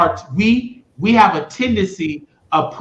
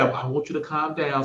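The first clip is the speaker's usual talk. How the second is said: much softer, steady and soothing; calm officer; 9 dB quieter than usual. Retold or imitated. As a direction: quote the cop calmly and softly. Quoting officer calming him.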